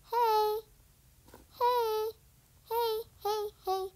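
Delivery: repeated calling "hey". Real, not acted. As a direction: try to get her attention.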